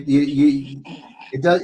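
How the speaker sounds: normal talking voice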